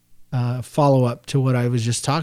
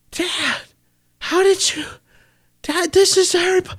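put-on high, choked child's voice, crying and amazed; son's tearful cry. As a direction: imitate a little boy overwhelmed, crying with joy. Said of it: "he's just bawling his little heart out".

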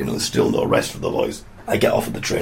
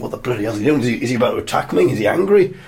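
mock alarm, climbing higher to the last question; freaked out; 4 semitones higher, faster than usual. Woman wondering if he's about to attack, mock freaked-out voice. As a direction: imitate rising panic. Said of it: "that would just freak her out".

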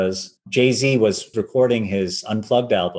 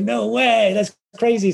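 much higher, playful mock amazement; amazed disbelief; 12 semitones higher, slower than usual. Producer hearing about the new violinist, amazed. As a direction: gasp it out like you can't believe it.

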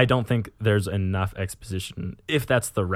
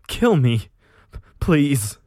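high, loud begging; acted pleading cry; agonized plea; 5 semitones higher, 6 dB louder, much slower than usual. A narrator's reading. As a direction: beg desperately through pain. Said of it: "murmured Andreas".

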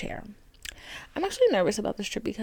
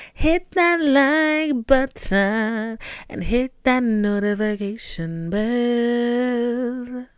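slow, louder drawn-out promo voice; hammed-up announcer; 6 dB louder, much slower than usual. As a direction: stretch it like a cheesy ad. Hammed-up call to subscribe.

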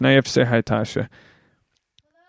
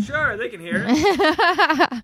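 laughing; 14 semitones higher, much slower than usual. Real, not acted. Teasing answer, laughing.

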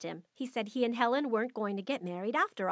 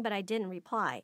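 plain reading voice

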